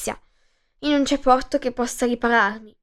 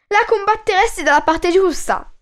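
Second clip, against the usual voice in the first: voce di ragazza più alta, incoraggiante; fanciulle che rincuorano; 7 semitones higher than usual. A narrator's reading. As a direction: rassicura il re con voce giovane e acuta. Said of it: "lo rincuorarono le fanciulle". (le fanciulle incoraggiano il re, voce alta e giovane)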